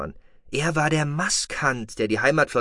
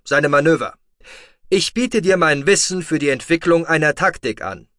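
loud, raised voice with commanding weight; forceful Terran commander; 4 semitones higher, 7 dB louder than usual. A narrator's reading. Terran offers his tactical knowledge to Otoba, loud and commanding. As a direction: declare it loudly, like a commander making an offer.

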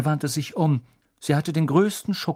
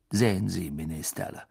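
low, softer, smug remark; Snape, coldly scornful; 5 semitones lower, 3 dB quieter than usual. A narrator's reading. Professor discredits the pupils to minister, scornful. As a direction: quietly make your point to the minister.

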